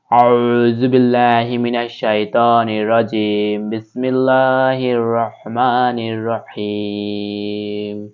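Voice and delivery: slow, melodic tilawat; Quranic recitation. Acted. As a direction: recite it with measured reverence. (কোরআন তিলাওয়াতের সুরে)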